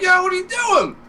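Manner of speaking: high, urgent mock yell; yelling at friend. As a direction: yell at him to stop. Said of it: "I kept yelling at you".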